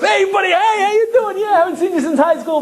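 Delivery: very high, fast, laughing buddy greeting; delighted reunion. Retold or imitated. Husband to his old schoolmate, high, laughing buddy greeting. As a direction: greet him like a long-lost pal. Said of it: "and goes".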